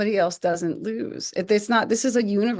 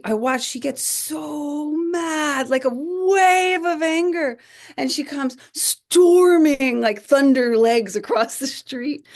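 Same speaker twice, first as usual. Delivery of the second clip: dramatic buildup; 6 semitones higher, 4 dB louder, slower than usual. Retold or imitated. Storytelling teacher's sudden anger.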